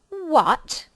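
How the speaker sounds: roaring witch. Acted. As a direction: roar the word in fury. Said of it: "roared the witch".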